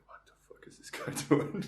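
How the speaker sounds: breathy, baffled aside; a dad muttering at his stoner son; dad's baffled whisper. Retold or imitated. Dad staring at his son's hair, whispered disbelief. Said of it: "be like".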